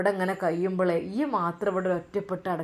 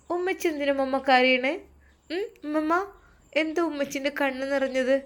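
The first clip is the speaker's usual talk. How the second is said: put-on worried little girl; 6 semitones higher than usual. Narrator voices little Aishu.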